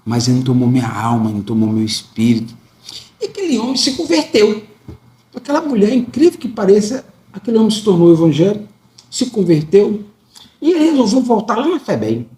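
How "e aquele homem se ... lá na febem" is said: much higher, lifted testimony voice; rising preacher fervour. Real, not acted. Preacher tells the congregation the man converted, voice lifted high and excited. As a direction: lift it up as the joyful turn of the story.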